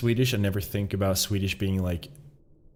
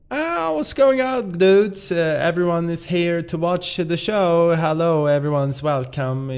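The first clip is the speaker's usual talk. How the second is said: put-on much higher, loud Swedish-accented host greeting; PewDiePie impression; 7 semitones higher, 4 dB louder than usual. Imitating a YouTuber welcoming everyone to the show, high Swedish accent. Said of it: "PewDiePie has a good English accent".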